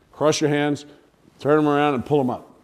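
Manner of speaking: usual speaking voice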